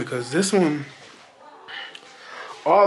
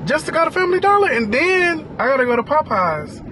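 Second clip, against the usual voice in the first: exasperated rant; 9 semitones higher than usual. Real, not acted. Ranting about the city.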